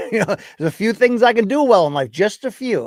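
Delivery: laughing at himself, self-mocking. Real, not acted. Admitting to chat he is not handy, laughing.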